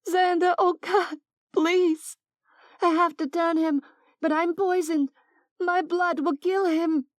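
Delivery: high, desperate rush of begging; frantic pleading. Acted. Oksana begs Xander to turn Mason, frantic and high.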